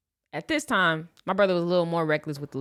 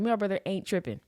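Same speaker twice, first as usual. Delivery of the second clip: ordinary talk, nothing marked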